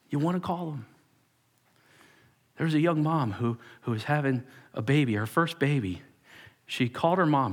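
ordinary delivery

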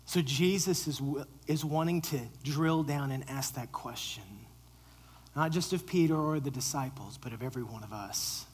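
usual speaking voice

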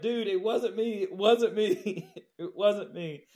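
loud, high, laughing plea; pleading protest. Retold or imitated. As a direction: beg him to believe it wasn't you. Pleading with screaming inspector.

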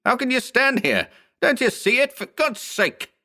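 high-pitched, desperate appeal; frantic plea. Acted. Lois to bystanders ignoring the body, frantic. Distraught man pleading with bystanders.

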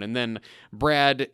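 ordinary delivery

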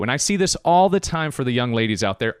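usual speaking voice

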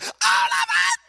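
high, loud mock screech of an angry wife; hammed-up shocked wife voice; shrill wife impression. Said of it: "That was kind of what her voice said".

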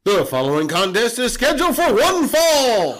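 booming ring announcer voice. Pretend. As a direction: announce it to the arena.

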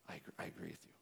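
secret whisper, breath only; hushed agreement from a classmate who snuck up. Retold or imitated. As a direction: whisper it like you're sneaking a confession. Kid sneaks up to agree.

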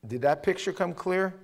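normal talking voice

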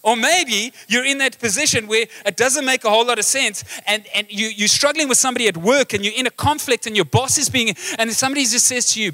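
ordinary talk, nothing marked

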